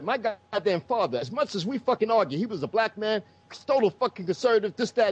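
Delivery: usual speaking voice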